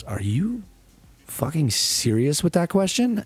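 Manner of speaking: a lightly voiced stunned girl, drawn out; offended girl. Girl stunned by his crude question, incredulous.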